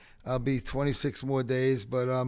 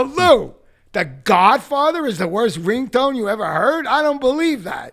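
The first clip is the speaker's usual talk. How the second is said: higher, louder, disbelieving comeback; incredulous retort; 11 semitones higher, 12 dB louder than usual. Retold or imitated. Narrator answering [name] about the ringtone, loud disbelief. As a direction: throw his words back in disbelief. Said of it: "I go".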